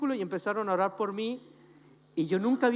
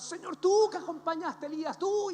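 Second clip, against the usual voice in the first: pompous prayer; 10 semitones higher, 4 dB louder than usual. Retold or imitated. Mocking overly formal prayers.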